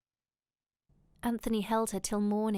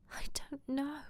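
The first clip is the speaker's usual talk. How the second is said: higher, soft, breathy near-cry; tearful; 5 semitones higher, 6 dB quieter than usual. A narrator's reading. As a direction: answer softly, on the edge of tears. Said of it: "She began to cry".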